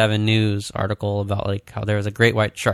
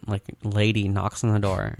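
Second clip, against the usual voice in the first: normal talking voice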